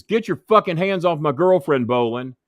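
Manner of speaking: loud, high mock bark; high, loud retold threat; retold angry threat. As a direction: snarl it like a jealous wrestler. Brock threatening narrator.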